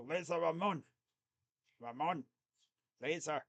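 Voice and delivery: a light Italian accent on "Laser Ramon", rolled "Ramon" with a pure o. Shown as a demonstration. Fan shows viewers the name in a light Italian accent. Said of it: "If you say Laser Ramon in, like, an Italian s-, accent".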